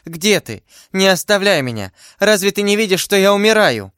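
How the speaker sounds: high plea, rising in urgency; anguished cry. A narrator's reading. Dying heroine begging her beloved to stay, anguished cry. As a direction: beg not to be left, mounting distress. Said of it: "вскричала Бонасье".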